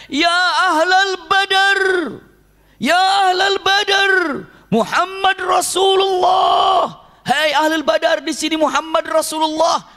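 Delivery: high, slow, loud battlefield shout; full shout. Retold or imitated. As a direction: cry out to the men of Badr, stretched and loud. (teriakan keras dan panjang memanggil ahli Badar)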